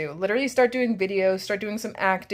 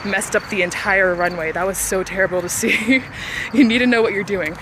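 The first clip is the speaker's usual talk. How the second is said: out of breath; 2 semitones higher, 4 dB louder than usual. Real, not acted. Talking while running.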